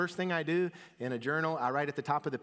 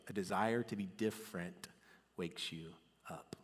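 soft emphasis; 5 semitones lower, 7 dB quieter than usual. Acted. Sermon point, softened.

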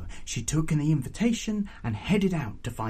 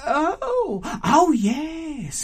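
high, drawn-out crowing; gleeful auntie; 5 dB louder, much slower than usual. Acted. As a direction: relish it in a high aunt voice. Aunt crows at horrified prince.

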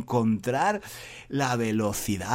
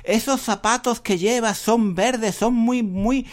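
higher, louder playful teasing of a student; teasing teacher; 8 semitones higher, 4 dB louder, slower than usual. Retold or imitated. Teacher mockingly teasing James about his green shoes. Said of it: "Entonces le digo".

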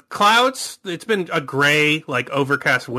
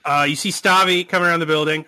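usual speaking voice

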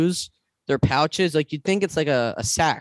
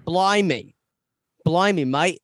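a put-on British accent drifting Australian, higher; mock British accent; 4 semitones higher, slower than usual. Friend hamming up a British accent for laughs. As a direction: say it like a cartoon Brit in a British accent. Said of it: "Australian with that voice".

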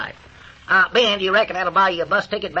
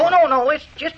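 eager protest; 10 semitones higher, 6 dB louder than usual. Acted. Refusing more blankets eagerly.

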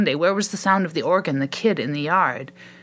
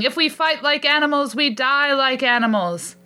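much higher rallying cry, slowing on the end; shouting; 9 semitones higher than usual. A narrator's reading. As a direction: shout it out like a battle call. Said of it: "someone shouting".

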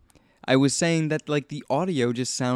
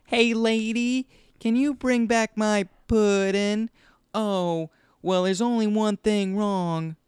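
high, slow, drawn-out imitation; mock movie-character voice; 11 semitones higher, 3 dB louder, slower than usual. Podcaster mimics the movie's odd audio, high drawn-out mock voice. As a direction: stretch each phrase up high, mimicking movie dialogue. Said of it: "the audio is really, like, you know".

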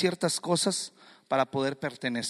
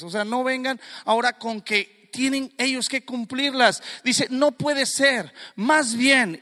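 raised, insistent pulpit challenge; impassioned preaching; 6 semitones higher, 3 dB louder than usual. Acted. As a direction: challenge the congregation with Peter's words.

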